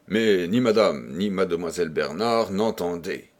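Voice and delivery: usual speaking voice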